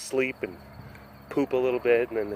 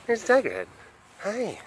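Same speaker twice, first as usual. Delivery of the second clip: talking to a pet; 8 semitones higher, faster than usual. Real, not acted. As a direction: coo it up high. High voice to animal.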